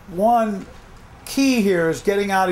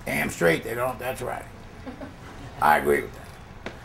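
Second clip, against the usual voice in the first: low, quiet, approving growl of a man; deep, understated macho agreement; agreeing tough guy; 8 semitones lower, 8 dB quieter than usual. Acted. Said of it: "Or you could say".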